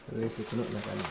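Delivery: softly spoken Japanese with a Javanese accent; Indonesian accent. Real, not acted. Vlogger repeating the Japanese phrase to viewers, Indonesian accent. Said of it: "Logatnya masih logat Indonesia".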